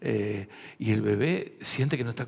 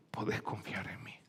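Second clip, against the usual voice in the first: gentle whisper; 4 semitones lower, 8 dB quieter than usual. Acted. Pastor voicing God's gentle words.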